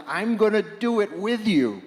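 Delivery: fervent joint pledge; rousing vow. Acted. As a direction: promise it with rising fervor. Voiced vow of a billion people.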